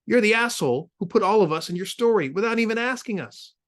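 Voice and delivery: high, loud furious accusation; angry accusation. Acted. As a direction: lash out in fury. Friend accuses angrily.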